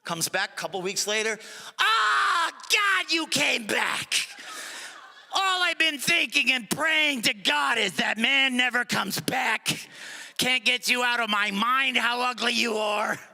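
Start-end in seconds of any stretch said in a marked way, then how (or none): 1.8-13.2 parrot voice. Acted. Parrot gleefully shrieking at the returning customer. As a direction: squawk with glee, half singing.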